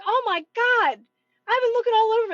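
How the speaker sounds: high, loud exasperated imitation; mock mad. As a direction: mimic an angry shopper at his wits' end. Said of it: "He was so mad".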